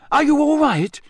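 high, loud, alarmed question. A narrator's reading. Harry to the man on his knees, loud and high. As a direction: call out to check on him, pitched right up. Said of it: "Harry said loudly".

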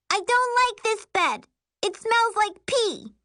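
high-pitched bratty insistence; little girl tantrum. Acted. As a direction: demand it like a stamping kid. Child's tantrum about the bed.

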